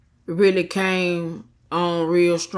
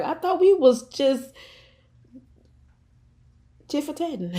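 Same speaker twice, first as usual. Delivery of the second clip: mock innocent; 8 semitones higher than usual. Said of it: "And I'm just like".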